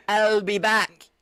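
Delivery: slow, heavy, robotic; a slow mock Terminator line; Terminator impression. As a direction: drag out each word like the Terminator.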